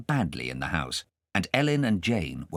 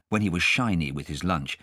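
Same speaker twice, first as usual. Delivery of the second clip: ordinary delivery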